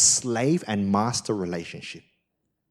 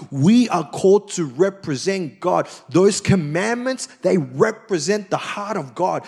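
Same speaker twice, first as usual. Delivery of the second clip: raised, fervent preaching voice, higher and louder; 6 semitones higher, 4 dB louder than usual. Acted. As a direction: proclaim it to the congregation. Preaching with rising force.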